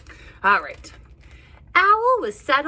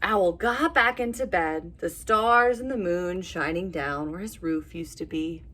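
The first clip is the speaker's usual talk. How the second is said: ordinary delivery, nothing marked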